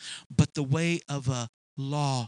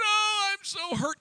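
higher, mock-whimpering child voice; whiny hurt child. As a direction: imitate a sore kid. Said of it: "they're crying, saying".

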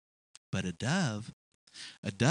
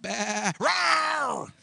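sheep bleat breaking into a higher roar; bleat turning into roar; 7 semitones higher, 3 dB louder than usual. Acted. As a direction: start as a lamb, erupt like a beast. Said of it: "you saw him and he went".

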